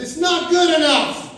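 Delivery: hammed-up furious nurse; angry nurse. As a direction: bark it at him like a cranky old nurse. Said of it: "She goes".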